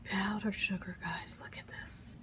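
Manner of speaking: soft hushed whisper over the food. Real, not acted. Cook whispering to viewers over powdered sugar. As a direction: whisper it like a secret at the stove. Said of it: "I don't know why I'm whispering".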